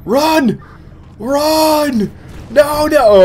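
high-pitched calls of alarm; mock panic. Gamer urging himself to get out of there, high mock panic. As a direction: call it out high, as if fleeing. Calling out while fleeing in game.

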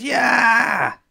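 very high, loud yell; full-on high-pitched scream; child's scream. Acted. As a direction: scream it out long to be noticed. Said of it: "I'm going to scream".

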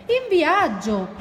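high, drawn-out proclamation; eager announcement. Acted. Porcospino answers where they are going, eager announcement. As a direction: announce it high and slowly, with relish. Friend announces the trip, pair with question.

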